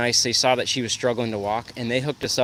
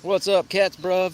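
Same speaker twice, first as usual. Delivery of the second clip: a hint of teen slang, higher and bouncy; daughter's slang greeting; 7 semitones higher than usual. Quoting the daughter's slang greeting, lightly mimicked and higher. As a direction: toss it off like a cool teenager. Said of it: "My daughter says that".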